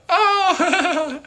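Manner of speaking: mimicking friend. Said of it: "she's like".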